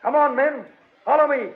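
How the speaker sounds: high, loud call to charge; a hero's battle cry; rallying shout. Acted. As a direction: rouse the men to follow you. Flash rallies the slaves to revolt.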